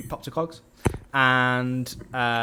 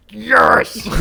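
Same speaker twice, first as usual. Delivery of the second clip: much higher and louder triumphant shout; cheering; 12 semitones higher, 11 dB louder than usual. Retold or imitated. Podcaster reliving the villain's stabbing, loud triumphant cheer. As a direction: punch the air with it. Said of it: "I was like".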